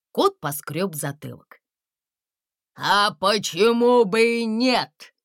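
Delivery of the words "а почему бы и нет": louder, slow booming rumble; a big dragon booming. A narrator's reading. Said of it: "прогудел предполагаемый дракон".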